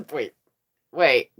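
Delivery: usual speaking voice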